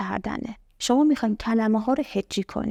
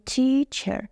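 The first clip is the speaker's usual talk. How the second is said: تیچر slowly, tee then cher; two slow beats in تیچر; split into syllables; 3 semitones higher, much slower than usual. Shown as a demonstration. Teacher concluding the syllable rule, split into syllables.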